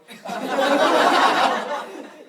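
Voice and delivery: big burst of laughter; loud laugh. Real, not acted. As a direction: crack up loudly. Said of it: "I couldn't keep a straight face".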